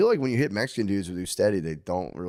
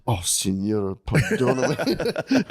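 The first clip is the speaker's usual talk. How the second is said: mock serious student; 2 semitones higher, much slower than usual. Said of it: "act very serious ... and be like".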